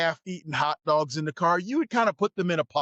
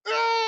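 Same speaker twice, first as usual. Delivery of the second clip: sudden high yelp as the burger hits; a shrill cry of shock, pitched way up; startled yelp. Retold or imitated. Brian Christopher hit in the chest, a high startled yelp. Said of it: "Brian Christopher going".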